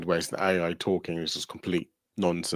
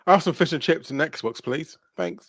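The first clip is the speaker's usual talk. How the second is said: customer ordering; 8 semitones higher, slower than usual. Pretend. Mock ordering takeaway.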